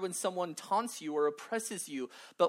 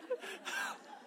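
breathy laugh; 5 dB quieter than usual. Real, not acted. Laughs after the impression.

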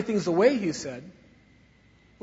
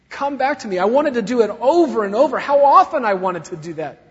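louder, higher urgent plea; fervent plea; 4 semitones higher, 9 dB louder than usual. Acted. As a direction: beg them to return. God pleading through prophets.

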